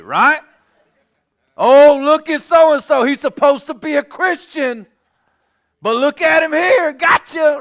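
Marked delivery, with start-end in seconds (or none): 1.6-7.6 high, loud, hammed-up exposé; mock gloating shout. Mocking people who blast a brother on Facebook, gloating shout. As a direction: crow it out to shame him publicly.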